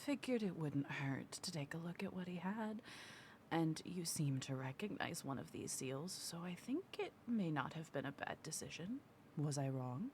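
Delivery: hushed conspiratorial whisper. Acted. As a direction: whisper it like a sneaky rogue. Rogue whispers in character.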